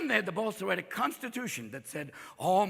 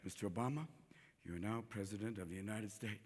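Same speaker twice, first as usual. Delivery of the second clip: low, hushed, solemn pronouncement; inauguration official; 11 semitones lower, 16 dB quieter than usual. Acted. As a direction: pronounce it softly and formally. Official swearing in the new president.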